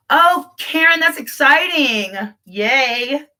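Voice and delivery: gasp, then high, drawn-out delight; real, happy excitement; excited for her. Real, not acted. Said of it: "I get excited for other people".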